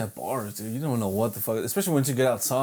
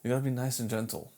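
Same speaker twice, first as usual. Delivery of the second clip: mock gentle; 7 semitones higher, 5 dB quieter than usual. Joking about being chill.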